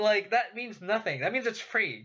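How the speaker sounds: ordinary talk, nothing marked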